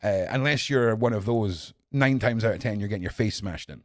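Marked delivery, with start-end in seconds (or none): none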